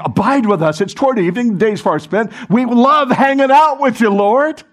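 rising, eager plea; eager invitation. Retold or imitated. Disciples beg the stranger to stay, rising eagerly. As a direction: invite him warmly, building up.

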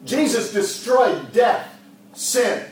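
loud, high, fervent exhortation; full-force preaching. Acted. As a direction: preach it out with fire.

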